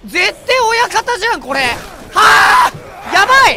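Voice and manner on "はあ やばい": high panicked shriek; startled scream. Real, not acted. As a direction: scream in sudden fright. Surrounded by enemies in horror game.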